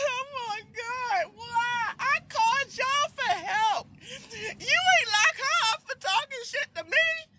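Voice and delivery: high whiny protest. Retold or imitated. Cuffed woman to officer, high whiny plea. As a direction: wail it at the officer.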